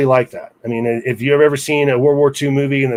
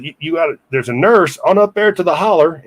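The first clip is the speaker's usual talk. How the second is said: put-on raised-pitch rural mountain accent; storekeeper's mountain accent; 4 semitones higher than usual. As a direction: imitate a country storekeeper giving directions, mountain accent. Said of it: "to do his accent here".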